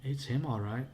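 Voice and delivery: plain reading voice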